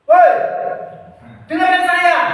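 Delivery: high, loud yell to demonstrate a shouting teacher; shouting demo. Shown as a demonstration. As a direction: bark it at the class. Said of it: "Bapak di sini nggak pernah seperti itu". (teriakan keras dan tinggi sebagai contoh)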